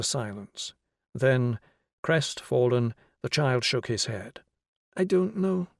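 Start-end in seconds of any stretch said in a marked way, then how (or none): none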